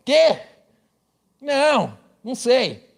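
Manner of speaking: bewildered Brazilian. Retold or imitated. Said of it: "e o cara".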